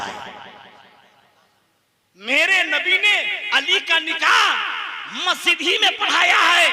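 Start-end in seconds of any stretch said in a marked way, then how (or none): 2.2-6.7 high, shouted proclamation; fiery pulpit cry; full-force repeat. Real, not acted. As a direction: declare it loudly to the whole gathering. Preacher repeats statement at full force.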